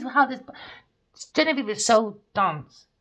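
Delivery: ordinary talk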